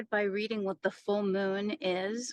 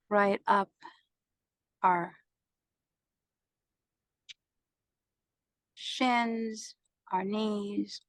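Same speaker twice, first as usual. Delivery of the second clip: even meditation voice with long pauses; guided meditation. Acted. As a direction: guide them through each breath, unhurried.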